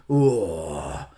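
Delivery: low, lecherous male moan; mock pervy groan. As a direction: groan like a creep ogling the screen. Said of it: "making perverted noises".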